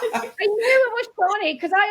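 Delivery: high, breaking into laughter; words squeezed through a laugh; giggly disbelief; laughing while talking. Real, not acted.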